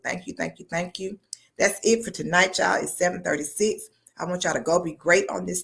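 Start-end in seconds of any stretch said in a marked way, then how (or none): none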